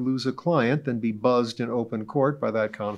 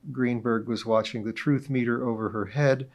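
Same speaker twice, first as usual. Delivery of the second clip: ordinary delivery, nothing marked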